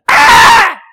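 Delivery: very high, loud goat bleat; imitated goat scream. Preacher imitating his hungry young goat, loud high bleat. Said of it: "and he goes".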